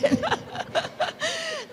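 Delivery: soft, high giggle; quiet high-pitched laughter; real laugh. Real, not acted. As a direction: giggle softly and high at your own joke. Laughs at her own advice.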